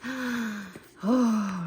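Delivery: delighted gasp. Real, not acted. Spots copper foiling in book.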